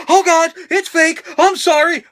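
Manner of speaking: a mock sudden sheepish backpedal; sheepish apology. Fan realising the fight is fake, mock sheepish apology. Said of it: "then I would be like".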